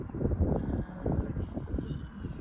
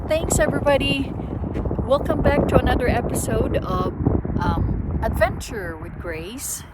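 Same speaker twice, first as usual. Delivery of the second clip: upbeat host welcome, louder; vlog host intro; 4 semitones higher, 6 dB louder than usual. Acted. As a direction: greet the viewers brightly.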